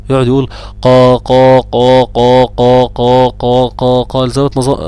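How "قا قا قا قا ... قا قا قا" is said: heavy قا repeated on one level note; repetitive قا drill. Retold or imitated. Teacher retells a student's all-day punishment, repetitive heavy قا drill. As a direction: chant قا over and over, evenly. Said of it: "خليته يقعد يقاقي طول النهار".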